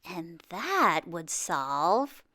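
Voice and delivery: slow, musing half-thought; slow pondering. Acted. Earl thinking aloud while shaving, slow pondering. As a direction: drag it out as if still working it out. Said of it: "Earl thought some more".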